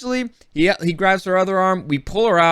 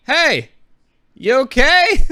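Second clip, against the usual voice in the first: loud, high call across the water; shouting to a stranger in the surf to check on her; yelling out; 10 semitones higher, 9 dB louder, much slower than usual. Retold or imitated. Yelling out to an old lady stuck at the water's edge. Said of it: "And so I yell out at her".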